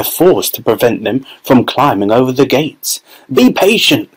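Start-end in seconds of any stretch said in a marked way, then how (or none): 3.4-4.1 higher, firm parental call; fathers calling. A narrator's reading. Fathers restraining eager children, firm call. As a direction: hold the children back with a call. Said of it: "cried the fathers".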